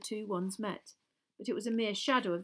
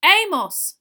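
high, very loud call; loud interruption; 11 semitones higher, 17 dB louder than usual. A narrator's reading. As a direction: cut in loudly on a colleague. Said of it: "said Mister Weasley loudly".

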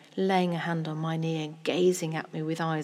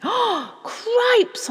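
gasping, very high and loud; child's shocked outburst; startled exclamation; 11 dB louder than usual. Acted. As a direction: cry out in sudden alarm.

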